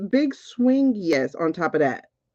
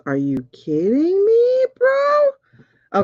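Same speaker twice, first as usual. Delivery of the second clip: thrilled disbelief; 13 semitones higher, 4 dB louder, much slower than usual. Real, not acted. Reader reacting to a yes card, squealing. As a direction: voice shooting much higher on "bro".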